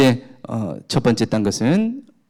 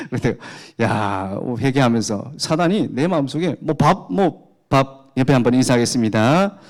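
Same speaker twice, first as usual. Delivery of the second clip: ordinary talk, nothing marked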